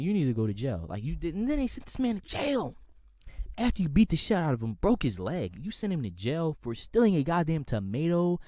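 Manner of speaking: loud, high, worked-up outrage; real indignant shouting rant; outraged ranting. Real, not acted. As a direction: yell it like you can't believe it. Outraged rant about cop beating.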